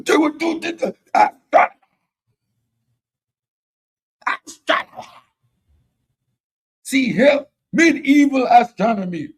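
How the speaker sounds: high, loud whooping caricature; mock whooping. Debater mocking opponents' bluster about astronomy, whooping loudly. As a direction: imitate opponents with hammed-up, stammering whoops. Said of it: "all of that extra whooping and whopping".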